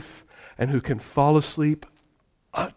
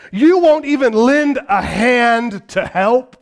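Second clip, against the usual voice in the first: loud, high, slow accusing shout; angry rebuke; 6 semitones higher, 5 dB louder, slower than usual. Acted. Disciples rebuking the lazy teacher for not helping, angry shout. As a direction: hurl it as an indignant reproach. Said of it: "They are rebuking the lazy teacher".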